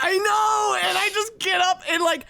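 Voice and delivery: high, loud, breathless rush; frantic retelling. Retold or imitated. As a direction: relive the panic as you tell it. Panicked dream retelling.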